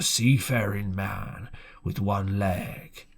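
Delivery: drawn out low, each word weighed; slow and ominous. A narrator's reading. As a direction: let "with one leg" hang slowly, like a threat. Ominous repeated phrase in narration.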